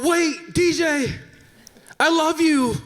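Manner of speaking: very high-pitched desperate call; retold yell. Host calling after the fleeing date, high-pitched pleading yell. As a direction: cry it out after someone running away. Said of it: "And so I yell out".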